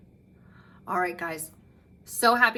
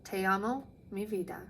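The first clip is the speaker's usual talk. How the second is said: soft, tender goodbye; grandpa's soft farewell in Spanish; 3 semitones lower, 9 dB quieter than usual. Acted. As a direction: say it gently like a loving grandpa.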